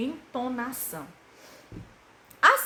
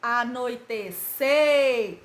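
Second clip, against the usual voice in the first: "anoitecer" said slowly, CER loud and held long; slow chanted "anoitecer" with a long last syllable; stress on last syllable; 5 dB louder, much slower than usual. Shown as a demonstration. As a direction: stretch the final "cer" of "anoitecer". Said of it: "As sílabas mais fortes são as últimas".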